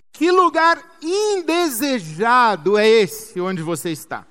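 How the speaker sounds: loud, emphatic sermon delivery with punched key words; forceful preaching. Real, not acted. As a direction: press the congregation, stretching the key words.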